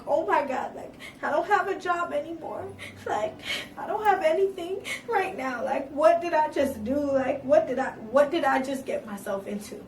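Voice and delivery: high, loud, near tears; panicked thoughts. Retold or imitated. Woman realizing she left her job, panicking close to crying. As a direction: spill the panic as it floods in.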